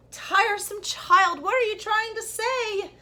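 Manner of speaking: slow, high, loud scolding mother; mother scolding her breathless son; impatient mother. A narrator's reading. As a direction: scold the tiresome child. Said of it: "said his mother".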